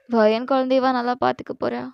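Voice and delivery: plain reading voice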